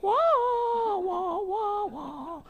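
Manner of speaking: high, squeaky comic "wah wah wah"; a cartoon sad-trombone wail; high mock wail mocking the visitor. Mock fanfare of arrival.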